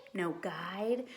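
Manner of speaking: usual speaking voice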